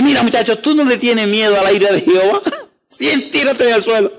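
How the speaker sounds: hammed-up frightened mom telling him to get down; imitating an alarmed mother; scolding mother.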